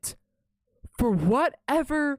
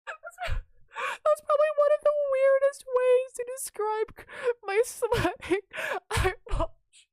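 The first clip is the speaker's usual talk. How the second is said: laughing; slower than usual. Real, not acted. Cracking up at her own phrase.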